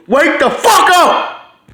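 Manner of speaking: loud, high, drawn-out yell; exasperated shout. Real, not acted. Vlogger rousing blind viewers, exasperated shout. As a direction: shout it slowly at full force to rouse them.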